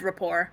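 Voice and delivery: "rapport" as ruh-POR; standard pronunciation. Shown as a demonstration. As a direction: stress the second half of "rapport". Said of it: "everyone I've ever met".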